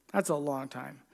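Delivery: soft low echo of the remark. Shown as a demonstration. Preacher repeats for effect.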